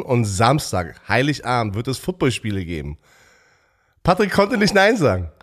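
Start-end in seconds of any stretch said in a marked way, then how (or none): 4.0-5.3 loud gleeful teasing, higher. Real, not acted. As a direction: rub it in with a grin. Teasing, mocking the host.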